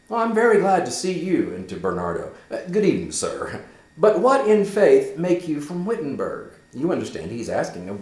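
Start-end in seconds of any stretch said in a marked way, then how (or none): 0.1-6.5 high, louder, eager questioning; Hamlet's eager questions. Acted. Hamlet asking Horatio why he came, higher and eager. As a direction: press a dear friend gladly.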